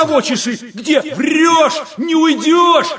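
нарастающий высокий громкий крик; кричать, хватая вора; крик в потасовке. Acted. Потасовка, ловят вора в толпе. (кричит в драке)

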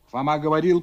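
usual speaking voice

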